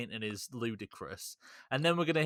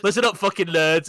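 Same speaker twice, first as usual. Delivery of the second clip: tough guy; 5 semitones higher, 13 dB louder than usual. Retold or imitated. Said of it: "the cool police and going".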